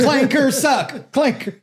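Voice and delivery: high, slow, goofy put-on voice; silly robot-hater voice. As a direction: drag it out in a dopey voice.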